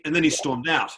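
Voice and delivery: ordinary talk, nothing marked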